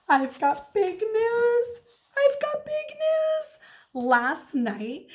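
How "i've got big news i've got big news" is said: put-on high, slow, thrilled little-girl voice; excited child. A narrator's reading. First grader reading her big news to the journal. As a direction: announce it like the best news ever.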